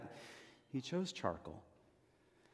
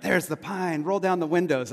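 higher, louder parent call-out; eager parents; 5 semitones higher, 7 dB louder than usual. Retold or imitated. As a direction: call out to the car like an excited parent. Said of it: "my parents would be like".

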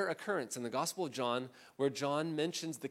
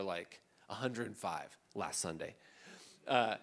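ordinary delivery

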